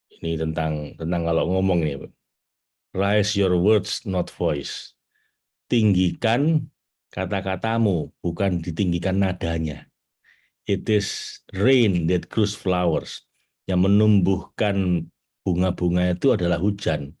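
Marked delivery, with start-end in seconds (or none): 2.9-4.9 very slow, each word set apart; slow recited quote. Shown as a demonstration. Teacher slowly reciting an English saying to the audience, very slow. 10.7-13.2 very slow, measured words; slow recited quote. Shown as a demonstration. Teacher reciting the rain proverb to the audience, very slow.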